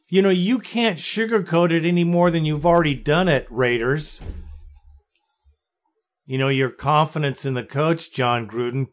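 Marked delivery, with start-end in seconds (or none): none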